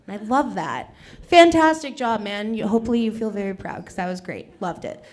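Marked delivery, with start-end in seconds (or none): none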